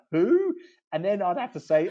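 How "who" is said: high, loud disbelieving who; incredulous who. Retold or imitated. Board member scoffing at an unknown law firm, high incredulous yelp. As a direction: squeak it out in mock disbelief. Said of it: "the next question would be".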